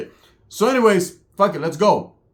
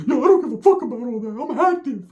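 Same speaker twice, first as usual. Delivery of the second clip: pitched way up, quick; fast high Ricky; 8 semitones higher than usual. Retold or imitated. Ricky brushing off the reassurance, high and fast.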